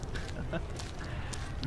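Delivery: a short soft laugh at herself; soft giggle about the bathroom hunt. Acted. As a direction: giggle quietly at the memory. Laughs at own bathroom story.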